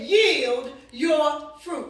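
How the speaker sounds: very slow, full-voiced command; commanding declaration. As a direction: stretch each word out with authority. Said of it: "Say it again".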